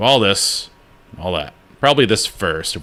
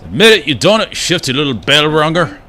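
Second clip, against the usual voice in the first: higher, louder, needling accusation; accusing grump character; 8 semitones higher, 5 dB louder than usual. Acted. Grump accusing the shifty bell ringer, higher and louder. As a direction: jab the finger at the stranger.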